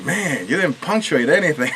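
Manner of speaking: amused mock complaint; laughing retold complaint. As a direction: imitate blunt feedback while starting to laugh.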